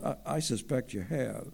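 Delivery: ordinary delivery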